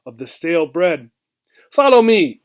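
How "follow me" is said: higher and louder, a curt command; order. A narrator's reading. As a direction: snap out the order. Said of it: "ordered the old mouse".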